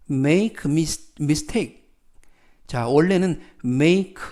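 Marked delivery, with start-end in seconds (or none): none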